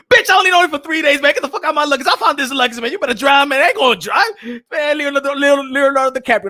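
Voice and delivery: mock-furious, fending her off the luggage; indignant outburst. Man defending his luggage from her, loud and indignant.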